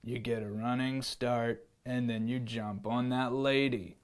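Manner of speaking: put-on the lion teaches the boy to pounce, low. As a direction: explain it low like a lion coach. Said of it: "said the lion".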